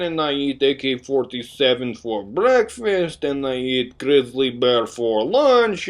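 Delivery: slow, hammed-up Russian accent; mock Russian accent. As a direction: play the stern Russian medic in a thick Russian accent. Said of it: "I'm totally kidding".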